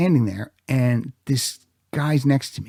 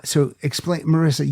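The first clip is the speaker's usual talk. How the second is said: ordinary talk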